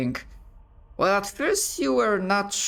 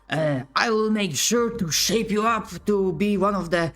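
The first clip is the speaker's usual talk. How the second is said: raised, barking drill-sergeant voice; drill colonel; 4 semitones higher than usual. Acted. Colonel lecturing his ragtag squad, barking drill voice. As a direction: bark it down the line at the recruits.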